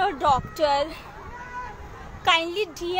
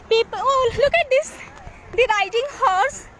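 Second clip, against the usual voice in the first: higher-pitched, delighted pointing out; real excitement, pitched up; excited exclamation; 5 semitones higher than usual. Real, not acted. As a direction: exclaim at a sudden lovely sight. Spots a horse on beach.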